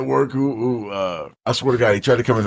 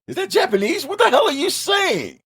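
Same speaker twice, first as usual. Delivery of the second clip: very high, incredulous squeal; comic high-pitched disbelief; baffled whale. Acted. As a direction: act a whale bewildered by foreign talk. Other whales baffled by odd whale.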